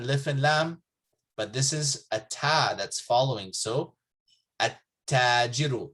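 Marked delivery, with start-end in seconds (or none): 4.6-5.9 at-tajiru with the lam silent, doubled t. Shown as a demonstration. Teacher models at-tajiru for Arabic learners, lam dropped. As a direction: say at-tajiru as att-tajiru, no l sound. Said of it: "you say at-tajiru".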